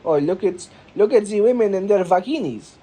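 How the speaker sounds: lightly put-on Italian accent, brighter; a hint of an Italian learner of English; a touch of Italian accent, eager; mock Italian accent. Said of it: "an Italian who's trying to learn English".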